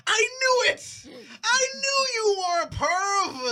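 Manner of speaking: high shrill accusing yell; shouting girl. Retold or imitated. Daughter yelling at stepdad she caught, high shout.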